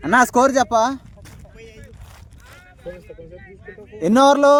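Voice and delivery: loud high call across a field. Real, not acted. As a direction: shout the question to a friend far away. Calling out across the ground.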